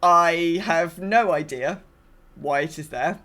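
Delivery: normal talking voice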